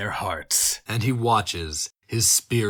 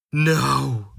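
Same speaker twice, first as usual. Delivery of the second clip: a mock shriek of "No!", barely raised; 4 semitones higher, 4 dB louder than usual. Onlooker shrieking as the life falls, understated cry. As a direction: cry out in narrated despair, held back.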